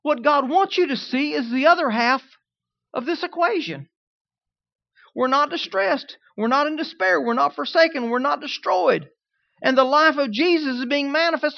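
loud, high preaching, leaning on every not. Acted. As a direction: preach it out to the congregation. Preaching the verse with force.

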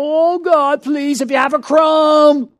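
a hint of a pleading beggar; mock begging prayer. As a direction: play the servant begging for crumbs. Mocking a begging believer's prayer.

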